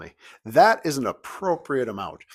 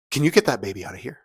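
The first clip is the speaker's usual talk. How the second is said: quick, louder question, played for effect; acted annoyed request; 3 semitones higher, 5 dB louder, faster than usual. Woman seated in front to the new parents, acted irritated request. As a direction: imitate an irritated woman turning round. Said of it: "let me do this for effect".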